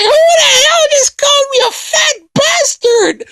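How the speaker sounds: very high, hammed-up screech of outrage; enraged Boo. Boo reacting to the overheard insults, enraged. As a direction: screech it like an insulted cartoon villain.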